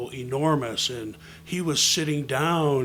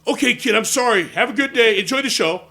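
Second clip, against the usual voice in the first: loud, high, flustered apology; hasty backing off; 8 semitones higher, 11 dB louder than usual. Retold or imitated. Man retreats from boy's imitation, flustered and loud. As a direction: back away apologizing to the kid. Intimidated man hastily backs off.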